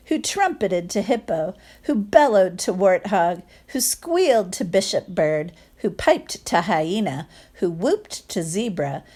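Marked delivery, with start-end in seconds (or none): none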